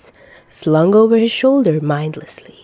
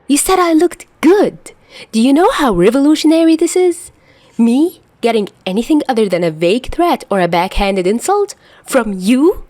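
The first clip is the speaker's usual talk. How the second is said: much higher and louder, giddy disbelief; gleeful teasing; 7 semitones higher, 4 dB louder than usual. Acted. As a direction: crow over the compliment like it's a miracle. Dazai gleefully teasing over compliment.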